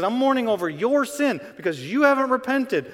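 high, loud urgent plea; urgent outcry. Retold or imitated. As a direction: call it out high and loud as Paul. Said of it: "He says".

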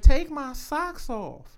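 slow, high-pitched mimicked request; imitated woman. Man mimicking a partner's request, imitated woman. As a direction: draw it out high, imitating her.

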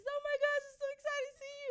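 squealing welcome imitation; gushing greeter. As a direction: imitate someone far too excited to meet you. Imitating an overeager church greeter.

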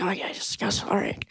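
breathy unvoiced dismissive yeses; mock whisper. [name] brushing off the fan manager in a whisper. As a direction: hush it out, brushing him off.

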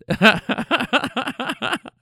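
high, long cackling giggle; real laughter. Real, not acted. Teller amused by the getaway mix-up, long real laugh. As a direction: crack up at the story.

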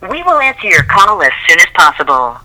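ordinary delivery

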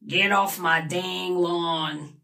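slow, creaky grandpa voice; grumpy old man. Acted. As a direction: drag it out like a rickety grandpa. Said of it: "put on a rickety grandpa voice".

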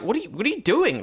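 startled. Retold or imitated. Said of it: "I was about to go, like".

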